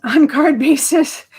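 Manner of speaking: sheepish laugh. Real, not acted. Laughs ending her rant.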